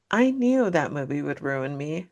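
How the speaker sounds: normal talking voice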